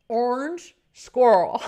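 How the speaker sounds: "Orange squirrel" slow and loud, squirrel squeezed into one syllable like an American; slow two-syllable squirrel. Shown as a demonstration. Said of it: "Let's make two syllables".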